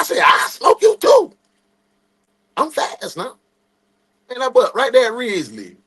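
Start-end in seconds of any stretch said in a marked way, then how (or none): 0.2-3.4 high, loud, slow boast; cocky teen shouting at his dad; boastful shout. Retold or imitated. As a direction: yell it like a cocky kid. Boast before a race with dad.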